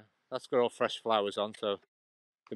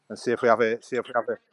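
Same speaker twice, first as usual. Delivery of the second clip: normal talking voice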